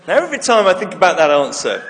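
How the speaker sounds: ordinary talk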